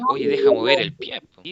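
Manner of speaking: high, loud mock complaint from a classmate; mock annoyed scold. A student imitating an irritated classmate, high and loud. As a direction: squeal out the annoyed request to stop. Teacher voices someone scolding fidgeting.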